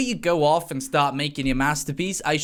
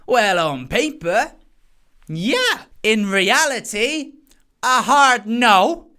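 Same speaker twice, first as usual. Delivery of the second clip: slow and louder on a hard no; emphatic hard no; 5 semitones higher, 5 dB louder, slower than usual. Acted. Mocking Trump's logic with a slow, loud hard no. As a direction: drag out a hard no. Mock weighing then firm no.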